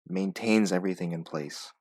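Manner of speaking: ordinary talk, nothing marked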